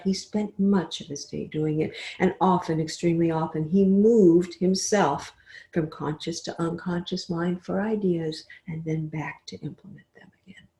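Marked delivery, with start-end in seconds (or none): 5.7-10.7 soft hushed whisper; hushed, trailing away into near silence. Shown as a demonstration. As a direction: whisper it like a secret drifting off. Describing slipping into unconscious mind.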